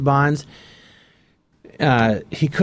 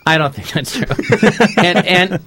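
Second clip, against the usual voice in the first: laughing denial, then a burst of laughter; 5 semitones higher, 4 dB louder than usual. Real, not acted. Guest laughing off the host's teasing, laughing. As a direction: laugh the tease away.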